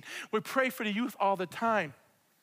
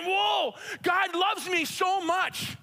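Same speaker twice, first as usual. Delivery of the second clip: awed whoa; 8 semitones higher, 3 dB louder, much slower than usual. Retold or imitated. Reliving moment of awe.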